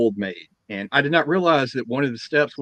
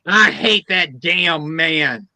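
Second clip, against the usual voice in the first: put-on very slow, louder cranky old woman; grumpy old lady; 4 semitones higher, 7 dB louder, much slower than usual. Old lady telling the assistant off, slow and cranky. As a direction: drag it out like a grumpy granny. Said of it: "the old lady goes".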